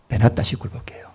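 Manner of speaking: breathy stage whisper to the congregation. Real, not acted. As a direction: drop to a hushed whisper for effect. Preacher drops to whisper.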